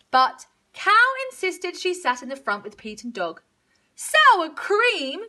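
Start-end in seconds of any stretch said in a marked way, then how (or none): 3.9-5.3 high, loud cow complaint; exasperated cow voice; mooing cow. A narrator's reading. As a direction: moo it out, high and peeved. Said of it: "she mooed".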